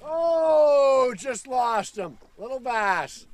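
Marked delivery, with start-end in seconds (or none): 0.0-1.1 surprised. Real, not acted. Fish jumps off the line.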